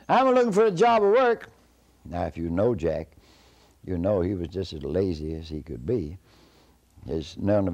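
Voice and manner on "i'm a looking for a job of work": very high, loud shout back; high-pitched long-distance call; hollered reply. Acted. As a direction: holler it up to the house from the gate. Jack hollers back from the gate.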